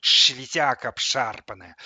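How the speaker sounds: loud lisped insult, "Шелезяка" with a hushing sh; lisping. A narrator's reading. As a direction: slur "Шелезяка" through a thick lisp. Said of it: "прошепелявил наушниками плеер". (шепеляво, «ш» вместо «ж» в «Шелезяка»)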